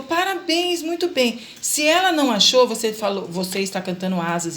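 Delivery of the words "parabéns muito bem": high, bright praise; praising a child. Shown as a demonstration. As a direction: cheer the child on, well above the usual pitch. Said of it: "se ela acertou".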